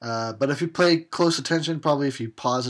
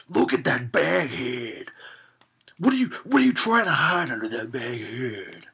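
terrible Macho Man impression; 3 dB louder than usual. Mocking baghead Jason as a mock Macho Man. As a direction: ham up the Macho Man badly. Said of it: "That was a terrible impression".

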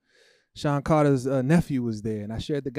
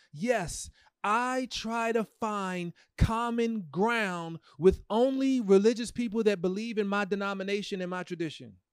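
mock; 7 semitones higher, slower than usual. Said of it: "Is that what your Bible says".

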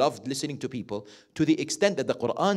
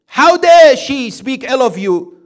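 indignant parent; 9 semitones higher, 14 dB louder, slower than usual. Pretend. Parent reacts to child's complaint.

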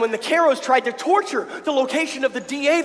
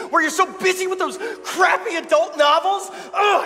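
frustrated outburst; 7 semitones higher than usual. Acted. Lovers' quarrel outburst.

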